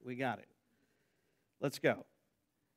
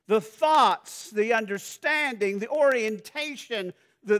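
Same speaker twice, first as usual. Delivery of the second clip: loud, high, ringing preacher's voice; building sermon delivery, big and high; full-force preaching; 8 semitones higher, 10 dB louder than usual. Acted. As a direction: preach it out to the congregation. Preaching on the spiritual mind.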